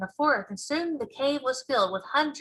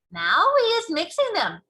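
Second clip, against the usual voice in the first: higher, louder grand announcement; giant announcing; 9 semitones higher, 6 dB louder than usual. Acted. Giant announces the mixing to Sophie, loud and proud. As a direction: proclaim it like a showman. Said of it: "the B F G announced".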